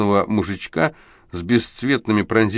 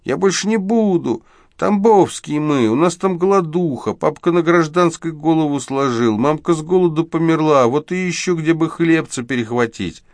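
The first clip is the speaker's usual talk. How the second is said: put-on the caught boy begs Saenko to let him go; shrill pitiful squeal; 10 semitones higher, 4 dB louder than usual. A narrator's reading. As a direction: wail it like a caught kid begging to be let go. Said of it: "прежним тоненьким жалобным голоском".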